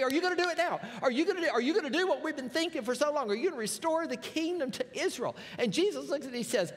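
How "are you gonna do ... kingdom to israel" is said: eager, rushing questions, pitched up; excited disciples. Retold or imitated. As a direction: blurt it out like impatient followers. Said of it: "they are so excited that they say".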